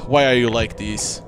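ordinary delivery

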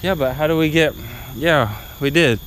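usual speaking voice

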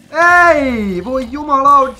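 loud, high angry outburst; real exasperated yelling; frustrated shout. Real, not acted. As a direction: really yell at the broken game. Game goes wrong suddenly.

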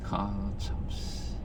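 whispered frustrated curse; cursing under breath. Real, not acted. Whispered curse after losing.

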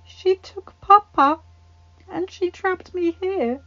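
high, slow tearful voice; choked-up child. Acted. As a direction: force words through a tight throat. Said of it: "feeling his throat growing tight".

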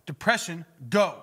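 loud, forceful order in prayer; firm command. Shown as a demonstration. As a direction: command it to leave. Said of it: "I command it".

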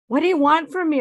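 high, loud desperate cry at her son; desperate outcry. Acted. Mother cries out at her son in the therapist's office, desperate.

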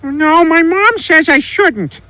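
put-on high, slow little-kid voice; child voice. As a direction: answer like a small obedient child. Peter as a child refusing.

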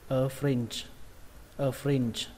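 "fringe" ending on a buzzing sound with little air; voiced soft ending. Shown as a demonstration. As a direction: let the end of "fringe" vibrate, without a puff. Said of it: "Âm chờ không bắn hơi mấy, âm chờ rung thôi".

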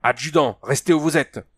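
sharp order. A narrator's reading. Officer checks the advancing Hans, sharp order. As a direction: order a subordinate to hold still.

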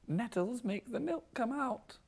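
high, slow, soft creepy voice; cartoon oddball. Retelling the nettles episode to friends, high soft slow character voice. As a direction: imitate an eerie cartoon character, pitched right up and drawn out. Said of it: "And he's like".

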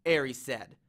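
ordinary delivery, nothing marked

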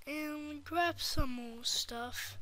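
usual speaking voice

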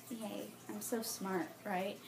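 soft, slow mock self-congratulation; deadpan little yay, said very softly; sarcastic. Real, not acted. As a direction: cheer for yourself weakly and sarcastically. Sarcastic self-mockery after failing.

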